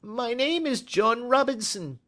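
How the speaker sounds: very high, uneasy character voice; evasive little man. A narrator's reading. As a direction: offer the false name in a small high voice. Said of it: "answered with a sidelong glance".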